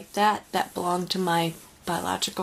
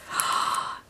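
delighted gasp. Real, not acted. Crafter finds a butterfly in the gift, delighted gasp. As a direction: gasp with happy surprise.

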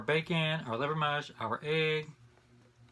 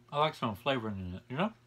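eating between words; talking while chewing; 4 semitones lower, 5 dB quieter than usual. Real, not acted. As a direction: mouth partly full. Seen 4: bite at -1.0, jaw moving, fork in hand while speaking.

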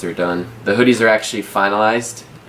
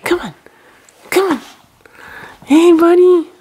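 pet talk; 4 dB louder than usual. Real, not acted. Talking to the pet bunny.

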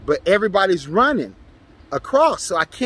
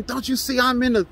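mock yell; 8 semitones higher than usual. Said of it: "She starts yelling and screaming".

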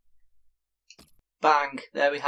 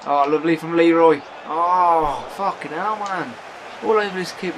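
1 excited yell; 4 semitones higher, 8 dB louder than usual. Real, not acted. Reacting to a near miss.

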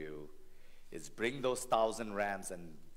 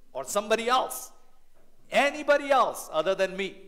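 desperate deflecting; 11 semitones higher, 9 dB louder than usual. Retold or imitated. Blaming everyone else.

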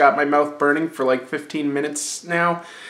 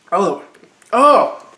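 sharp high pained oh; pained gasps; 6 semitones higher, 7 dB louder than usual. Real, not acted. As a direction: cry out as the heat hits. Pepper heat hits hard.

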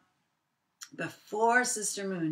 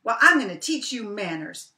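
louder threatening rabbit; angry Brer Rabbit; 3 semitones higher, 9 dB louder than usual. Acted. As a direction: threaten to teach the tar baby manners. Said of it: "And he said".

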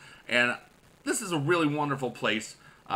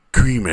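creamy call; 3 semitones lower, 12 dB louder than usual. Pretend. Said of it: "I haven't creamied on camera".

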